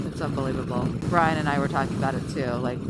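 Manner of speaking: normal talking voice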